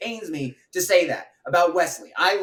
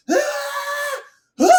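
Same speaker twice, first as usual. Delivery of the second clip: short high imitation fight scream; sudden high "Ah!", imitating screamy fight scenes; mock scream; 3 dB louder than usual. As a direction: let out a brief mock battle shriek. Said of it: "where they just scream".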